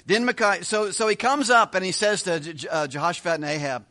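normal talking voice

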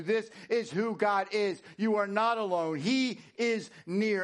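swelling sermon climax; impassioned preaching. Acted. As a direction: drive each promise home forcefully. Preaches promises at full force.

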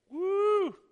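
high, sustained "woo"; excited whoop. Real, not acted. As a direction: whoop with delight mid-sermon. Preacher whoops at his point.

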